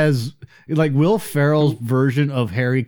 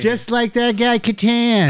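Norm Macdonald impression; 6 semitones higher than usual. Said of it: "one of the few impressions I can do".

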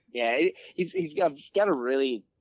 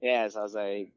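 normal talking voice

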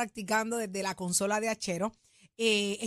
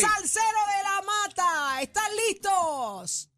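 announcer hype; 8 semitones higher, much slower than usual. Shown as a demonstration. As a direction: hype the crowd like an emcee. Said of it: "Tírale, tírale esa voz".

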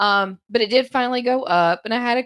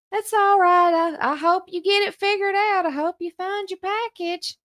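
put-on spry old voice; 10 semitones higher than usual. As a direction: play a kind old neighbour. Old lady voiced in story.

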